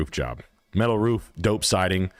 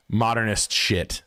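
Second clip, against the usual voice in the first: ordinary delivery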